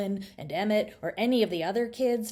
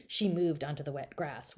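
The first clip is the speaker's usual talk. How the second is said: usual speaking voice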